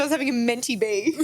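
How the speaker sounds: ordinary talk, nothing marked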